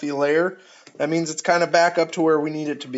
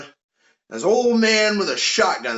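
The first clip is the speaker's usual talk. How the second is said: ordinary talk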